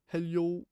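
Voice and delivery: trying out a silly hello. Shown as a demonstration. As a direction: slide a y into hello. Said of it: "Like that".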